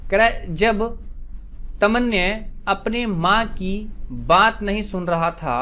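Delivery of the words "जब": normal talking voice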